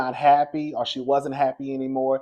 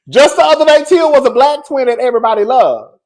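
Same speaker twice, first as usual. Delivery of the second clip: laughing; 10 semitones higher, 11 dB louder than usual. Real, not acted. Vlogger marvelling at fans turning on Tia, laughing loudly. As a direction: repeat it laughing, higher and louder.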